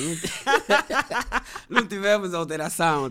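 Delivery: laughing through the words. Real, not acted. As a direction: burst out laughing as you speak. Laughing about no revisions needed.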